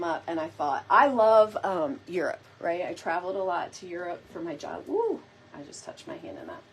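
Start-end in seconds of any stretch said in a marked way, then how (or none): 4.9-5.2 excited whoop. Real, not acted. Excited about Europe.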